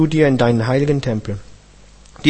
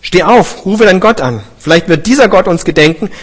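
urgent captain; 6 semitones higher, 4 dB louder than usual. A narrator's reading. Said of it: "Da trat der Schiffskapitän zu ihm und sprach".

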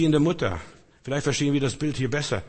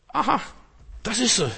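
higher, bright aha of discovery; sudden aha; 7 semitones higher than usual. Acted. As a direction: act out a sudden realization. Acting out an aha moment. (überraschtes Aha, deutlich höher)

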